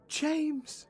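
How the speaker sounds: quietly excited, trying not to be heard; hushed and amazed, don't wake the house; hushed discovery. Acted. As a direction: gasp then a soft urgent call. Discovering hidden journals, hushed.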